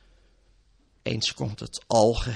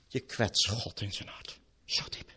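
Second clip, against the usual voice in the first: hushed whisper; 4 semitones lower, 4 dB quieter than usual. Acted. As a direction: whisper it, grave and close. Preacher drops to a whisper.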